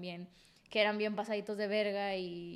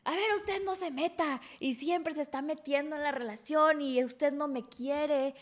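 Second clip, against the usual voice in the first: put-on whiny drunk boyfriend; 5 semitones higher, 4 dB louder, slower than usual. Boyfriend yelling at her mother.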